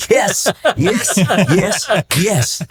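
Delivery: high, laughing cheer of vindication; triumphant glee. Retold or imitated. Husband savouring payback on his wife's tormentor, gleefully triumphant.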